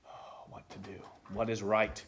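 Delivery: unvoiced inner thought, breathy hush; whispered worried musing. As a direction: whisper the dilemma to yourself. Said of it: "and thought".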